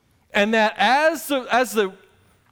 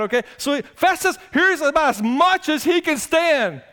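usual speaking voice

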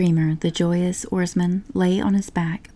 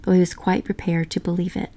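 ordinary delivery

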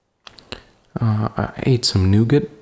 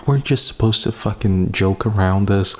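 usual speaking voice

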